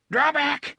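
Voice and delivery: high, loud gasping command; panted warning. Acted. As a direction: pant it out. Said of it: "he panted".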